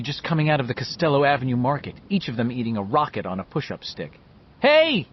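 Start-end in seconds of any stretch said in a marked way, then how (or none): 4.6-5.1 high, loud hail; shout. A narrator's reading. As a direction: yell to friends down the street. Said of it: "he shouted".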